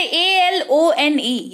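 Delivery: slow, clear spelling for learners. Shown as a demonstration. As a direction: spell ए एल ओ एन ई letter by letter, slowly. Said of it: "word spelling जो है उसमें ऐसे पढ़ेंगे".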